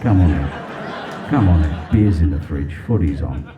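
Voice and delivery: very low, easygoing Aussie bloke; Australian God. Acted. Australian God welcoming the soul in, deep blokey voice. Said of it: "An Australian god".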